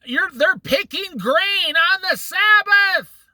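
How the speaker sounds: high, slow shouted accusation; mock shout. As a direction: yell it like a running, pointing Pharisee. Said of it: "running towards Jesus and saying".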